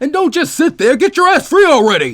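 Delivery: high, loud furious yelling. Acted. Asuma yelling at Naruto to get free. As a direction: yell down from the stands. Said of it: "Asuma yelled".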